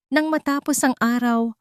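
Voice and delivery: usual speaking voice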